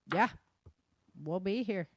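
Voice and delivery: ordinary talk, nothing marked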